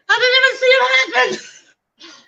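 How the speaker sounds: high, squeaky, crying mock of a helpless guy; mock whiny Jason. Wife mocking husband who missed the fight, high whiny cry. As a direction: whine it like a startled kid. Jason's reaction retold, mocked.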